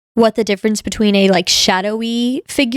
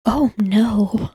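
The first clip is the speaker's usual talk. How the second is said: whisper. Real, not acted. Spooky story, whispered reaction.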